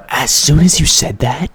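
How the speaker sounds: booming repeat of his line; loud self-impression. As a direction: blast it out like a yell. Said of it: "great impression of yourself".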